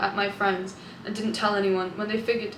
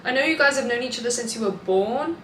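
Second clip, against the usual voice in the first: ordinary delivery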